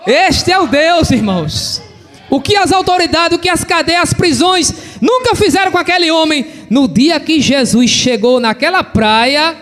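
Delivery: high-pitched, driving pulpit delivery; preaching. Real, not acted. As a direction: preach it with rising intensity. Preaches at full force.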